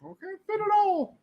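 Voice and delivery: tiny, very high falsetto wail; drawn-out falsetto complaint; mock complaining characters. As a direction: squeak it slowly like helpless little creatures. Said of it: "they're like".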